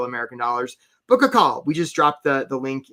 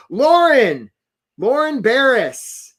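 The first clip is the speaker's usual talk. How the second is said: calling out; 7 semitones higher, 6 dB louder, much slower than usual. Real, not acted. Shouting out a correct answer.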